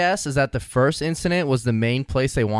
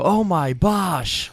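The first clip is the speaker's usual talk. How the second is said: Chuck Swirsky; 4 semitones higher, much slower than usual. Retold or imitated. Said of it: "he'd always go".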